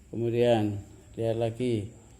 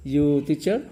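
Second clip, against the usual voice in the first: "you teacher" with pitch rising on "teacher"; question intonation; 3 semitones higher, 6 dB louder, slower than usual. Shown as a demonstration. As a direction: ask "you teacher" by tone alone, louder. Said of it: "ucapannya seperti bertanya".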